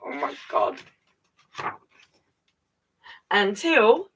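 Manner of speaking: ordinary delivery, nothing marked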